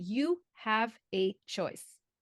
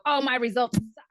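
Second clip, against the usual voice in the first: mock dismay; 3 semitones higher, 6 dB louder than usual. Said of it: "a scientist doesn't go".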